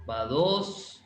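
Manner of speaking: ordinary talk, nothing marked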